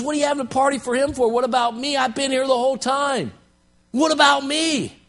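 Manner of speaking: high, fast, aggrieved whining; resentful older brother. Retold or imitated. Older brother protests the party thrown for his brother, aggrieved. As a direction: complain that nobody thought of you.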